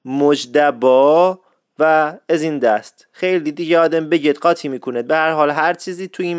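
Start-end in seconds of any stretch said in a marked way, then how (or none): 0.1-1.4 مجدبا said very slowly with a d for the j, a little louder; Isfahani pronunciation. Shown as a demonstration. Radio host giving the second Isfahani variant, very slow. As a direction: draw out مجدبا slowly, keeping the b.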